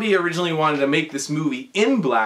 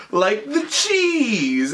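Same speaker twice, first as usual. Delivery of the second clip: drawn-out explanation; 6 semitones higher, much slower than usual. Retold or imitated. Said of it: "And, and then he goes".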